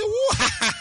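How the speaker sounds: high laughing shout of Whoa. Shown as a demonstration. Preacher showing the church how to laugh, a high laughing whoa. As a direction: burst out laughing on the word. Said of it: "engage laughter".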